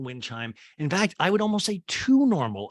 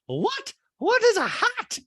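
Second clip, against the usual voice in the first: much higher, louder, slow put-on socialite voice; high loud slow caricature of a gushing rich woman; Dorit voice; 5 dB louder, slower than usual. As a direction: gush it in a high, loud mock-posh voice. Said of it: "And Dorit's like".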